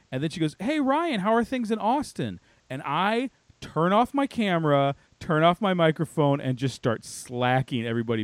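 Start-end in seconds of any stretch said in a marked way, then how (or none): 0.6-2.4 high, chirpy imitation of a friendly stranger; bubbly newcomer. Stranger singles out a colleague on the call, mock bubbly. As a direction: greet with mock bubbly brightness, pitched well up. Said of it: "way too bubbly".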